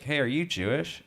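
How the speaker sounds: put-on high, eager kid asking; curious kid. Comic imitating a kid at driving school, high and eager. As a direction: ask like a nosy teenager. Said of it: "he was like".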